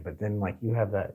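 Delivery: usual speaking voice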